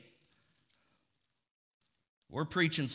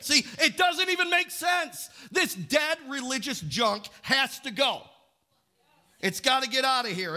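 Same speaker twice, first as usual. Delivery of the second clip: high, raised-voice outburst; forceful preaching; 7 semitones higher, 3 dB louder than usual. Real, not acted. Preacher rejecting dead religious junk, forceful and raised. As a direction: drive it home to the congregation, high and loud.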